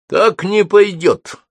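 high, loud, slow declaration; sudden objection. A narrator's reading. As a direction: break in firmly with a sudden decision. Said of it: "сказал вдруг Холл". (внезапное громкое возражение)

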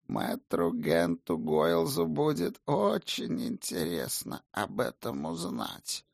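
put-on slow quavering old man's voice. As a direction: creak it out like an old man. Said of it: "сказанную старческим дребезжащим голосом".

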